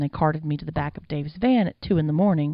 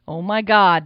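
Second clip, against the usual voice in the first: loud, horrified exclamation; crying out; 3 semitones higher, 9 dB louder, slower than usual. A narrator's reading. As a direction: cry out in shock. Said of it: "Dave cried".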